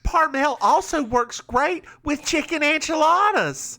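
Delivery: high, perky Southern ad testimonial; Southern accent. Acted. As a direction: sell it in broad Southern. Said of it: "with a Southern accent like that".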